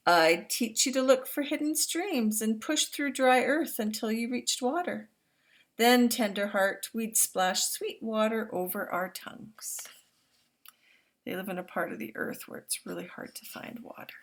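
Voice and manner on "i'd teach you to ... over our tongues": louder, expressive read-aloud voice; storybook reading to young listeners; reading to children. Acted. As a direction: read it out big and warm for the class. Reads picture book aloud.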